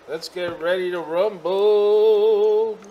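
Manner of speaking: slow, loud, high boxing announcer; ring announcer. Pretend.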